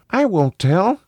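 put-on high-pitched small boy; high child voice; little child. A narrator's reading. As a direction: answer like an uneasy young child.

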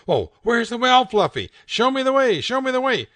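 high, loud hammed-up urgency; urgent TV owner. Owner urging Fluffy to show the well, loud high urgency. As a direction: beg the cat to lead the way.

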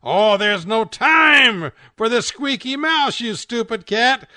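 high, loud, slow furious yell; angry shout. Acted. Owner losing patience with the cat, slow loud yell.

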